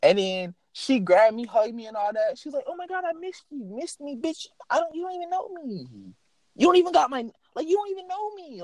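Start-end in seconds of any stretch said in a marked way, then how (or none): 2.7-3.6 high, soft put-on gush; mock an overjoyed girl in a high, softer voice; imitated gushing girl. Girl hugging him after running over, mock high gushing. 3.6-8.6 high-pitched, incredulous protest; mock indignation. Protesting to the girl who hugged him, high mock indignation. Acts his indignant reply to her.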